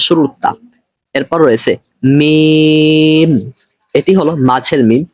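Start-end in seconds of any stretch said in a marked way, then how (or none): 2.2-3.3 মিম held very long on one steady pitch; teacher holding মিম so students can copy; drawn-out letter. Shown as a demonstration. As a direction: stretch মিম out, humming the m. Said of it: "উচ্চারণগুলো আমি যেরকম করতেছি".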